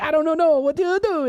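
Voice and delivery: high, loud mock Italian accent; hammed-up Italian hollering; mocking Italian yell. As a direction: make fun of him in his own Italian accent. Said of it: "just making fun of him, yelling at him".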